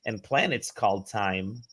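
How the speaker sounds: usual speaking voice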